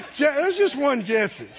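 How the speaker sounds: laughing through the words; can't stop chuckling while telling it; real laughter breaking in. Real, not acted.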